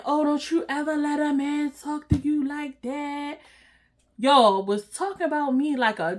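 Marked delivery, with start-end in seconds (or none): 0.0-3.4 slow, lightly voiced preachy friend; a hint of smug sisterly advice, drawn out; mock girl-power advice. Mocking Rhonda's fake girl-power advice to her, slowly. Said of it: "trying to be all girl power".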